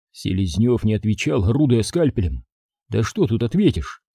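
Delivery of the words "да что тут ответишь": ordinary delivery, nothing marked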